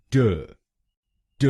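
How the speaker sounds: t said alone, crisp and isolated; second syllable reduced to a bare t; syllable demo. Shown as a demonstration. Teacher drills the second syllable, crisp. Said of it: "टीईआर हम बोलेंगे".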